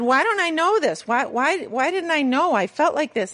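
bewildered plea. Retold or imitated. Retelling her plea to therapist.

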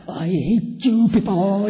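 hammed-up silent fury; inner hatred. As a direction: spit it out slowly like a secret grudge. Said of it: "I'm like".

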